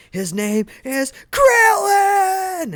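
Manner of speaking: anguished shout. Retold or imitated. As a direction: scream the name out in grief and rage. Goku loses it over his friend.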